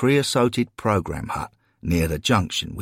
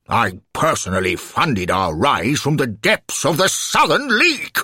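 pompous alderman swelling with outrage; indignant bluster; 7 semitones higher, 5 dB louder than usual. Acted. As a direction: bluster louder and higher to a peak. Affronted alderman blusters in self-defence.

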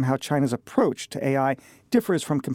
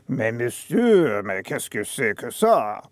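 slow, low, put-upon Parisian grumble; grumpy French staff; 5 semitones lower, slower than usual. Pretend. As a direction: sigh it like a grumpy waiter. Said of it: "the staff are all grumpy".

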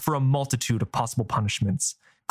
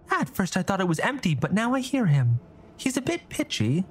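put-on light, higher young girl voice; young girl, higher and quick; girl's voice; 7 semitones higher than usual. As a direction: voice her as a curious young girl, pitched up.

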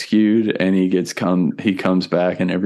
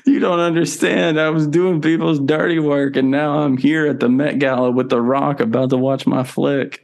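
excited; 6 semitones higher than usual. Retold or imitated. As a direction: gush about meeting The Rock. Character at the gala.

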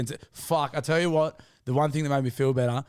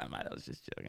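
soft, low, unvoiced backing down; meek backpedal; 17 dB quieter, faster than usual. Bloke in trouble backing out of a fight, meek whisper. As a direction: whisper it meekly, talking your way out. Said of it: "I'm like".

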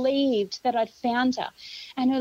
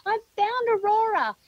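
high, drawn-out holler without full volume; mock scream; 9 semitones higher than usual. Grandmother to Jamie down the mountain, retold high scream. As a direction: call the news out long and high. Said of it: "I screamed down the mountain".

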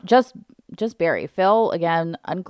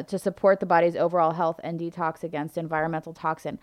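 usual speaking voice

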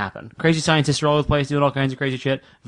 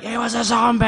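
high, earnest protest; mock-earnest high excuse; pleading excuse; 10 semitones higher than usual. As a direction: insist it like it's obvious. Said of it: "And I'll be like".

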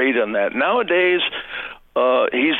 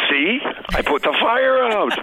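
higher, puffed-up mock boast; imitating the politician; 10 semitones higher than usual. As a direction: brag like the man who lit the fire himself. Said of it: "and then says".

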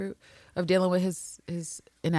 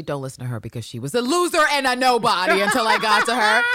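louder, higher imitation of a scornful coach; mock scornful outburst; 5 semitones higher, 5 dB louder than usual. Coach interrupts to put down former pupil, mock scorn. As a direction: cut in loudly, playing the coach.

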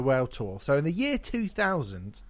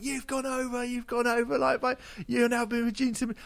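imitated urgent nagging; 10 semitones higher than usual. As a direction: mimic someone calling time through a door. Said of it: "banging on the door, being like".

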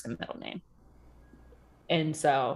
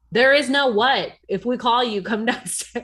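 parent scolding; 6 semitones higher, 8 dB louder than usual. Retold or imitated.

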